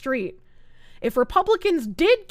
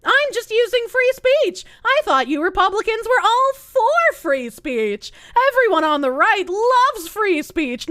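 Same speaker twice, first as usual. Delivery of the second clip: mocking impression; 6 semitones higher than usual. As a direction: ham up a whiny free-speech troll. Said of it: "by the way".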